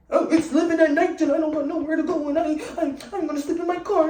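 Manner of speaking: loud, high-pitched blubbering imitation; hammed-up boo-hoo wail; mock crying whine. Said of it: "puffing and crying and whining".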